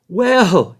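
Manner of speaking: put-on a sudden, loud "Well" from an old farmer; loud exclaim. A narrator's reading. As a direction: burst out with surprise. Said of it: "he exclaimed".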